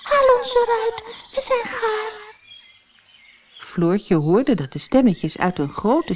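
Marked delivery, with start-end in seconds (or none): 0.0-2.2 very high little bread voices calling; tiny voices. Acted. Little loaves call to Floortje from the oven, tiny and high. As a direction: squeak like small loaves. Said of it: "een heleboel kleine stemmetjes roepen".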